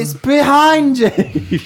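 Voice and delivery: high, slow panto call; panto crowd callback. Pretend. Panto catchphrase.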